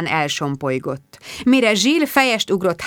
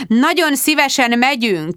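snapping; 7 semitones higher, 4 dB louder than usual. A narrator's reading. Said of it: "csattant föl Sharon".

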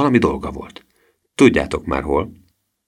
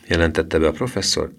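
plain reading voice

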